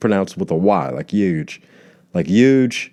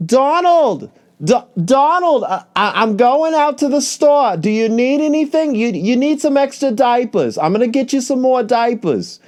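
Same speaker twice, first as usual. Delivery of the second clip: much higher, put-on voice of a wife fussing over an old husband; doting wife. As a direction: coo like a wife caring for a baby. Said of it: "this woman will be like".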